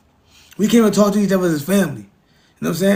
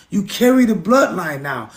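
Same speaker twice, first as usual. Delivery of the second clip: ordinary delivery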